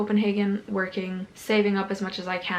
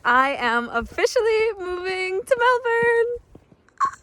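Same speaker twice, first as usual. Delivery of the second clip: excited; 11 semitones higher, 4 dB louder than usual. Real, not acted. Repeating the news, overjoyed.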